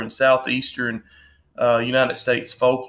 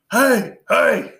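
calling out loud over a crowd; bar shout; 8 semitones higher, 5 dB louder than usual. Retold or imitated. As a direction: shout to get attention at a bar.

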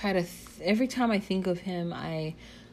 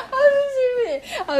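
higher, louder bursts of laughter through the words; laughing at herself; 10 dB louder than usual. Real, not acted. Vlogger laughing at her own crude slip, real giggles. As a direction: crack up at your own slip of the tongue.

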